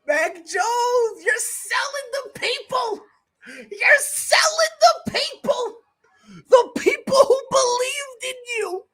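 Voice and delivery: high, pleading wail at the player; mock betrayed plea. Real, not acted. Streamer scolding the quarterback on screen, high wailing lament.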